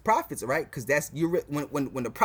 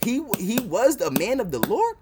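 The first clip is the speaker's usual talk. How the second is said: imitating future believers; 8 semitones higher, 4 dB louder than usual. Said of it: "that's what you people gonna say".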